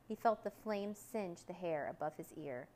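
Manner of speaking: ordinary delivery, nothing marked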